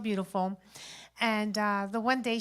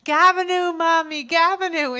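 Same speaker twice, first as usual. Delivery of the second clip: put-on high, loud child's yell of Gabinoo; a kid hollering Gabinoo over and over, higher and louder; toddler screaming; 6 semitones higher, 7 dB louder than usual. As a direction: shout Gabinoo like a little girl demanding her song. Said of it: "she's screaming for two hours".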